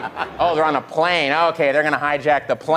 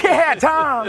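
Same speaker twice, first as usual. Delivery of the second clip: excited cheer; 12 semitones higher than usual. Retold or imitated. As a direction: cheer like he won a prize. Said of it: "He was like".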